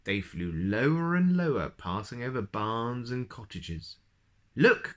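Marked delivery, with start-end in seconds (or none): 4.6-4.9 much higher, louder call; excited cry. Acted. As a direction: point it out eagerly. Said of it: "cried Mister Tickle".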